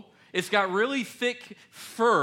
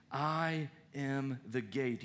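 slow and soft; slow quiet scripture; 4 semitones lower, 7 dB quieter, slower than usual. Shown as a demonstration. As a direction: draw it out reverently. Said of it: "He says it twice".

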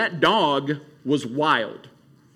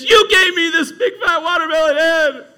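put-on loud, high laughing kid complaint; a kid blaming his parents, half laughing; mock accusing kid; 10 semitones higher, 8 dB louder than usual. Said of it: "I didn't go home to ask my parents".

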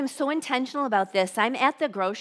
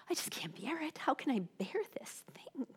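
higher, hushed, whispered plea; whispered despair; 3 semitones higher, 11 dB quieter than usual. Retold or imitated. Voicing a listener crushed by bad news, whispered despair. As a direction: whisper it as someone overwhelmed. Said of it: "You're just like".

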